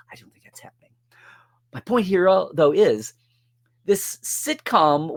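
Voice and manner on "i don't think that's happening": whispered aside. Real, not acted. Aside muttered under breath.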